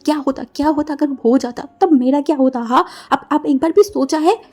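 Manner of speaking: loud, outraged retort; angrier threat. Acted. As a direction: fling the words back at him loudly. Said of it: "गुस्से में wing को धमकाते हुए बोला". (और गुस्से में ज़ोर से धमकाना)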